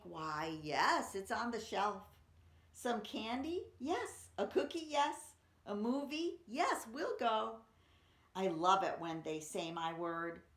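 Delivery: bright, building excited read-aloud; eager children's poem reading; enthusiastic poem reading. Acted. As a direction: read it with rising delight for kids.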